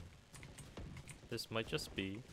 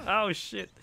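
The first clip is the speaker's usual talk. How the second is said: high, loud startled curse; jolted by a sudden scare; scared horror game streamer; 8 semitones higher, 9 dB louder than usual. Real, not acted.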